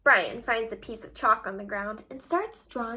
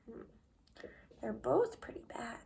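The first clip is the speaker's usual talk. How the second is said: put-on hushed whisper to the children; whispered aside; 3 semitones lower, 10 dB quieter than usual. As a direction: lean in and whisper it sadly. Narrator's quiet aside.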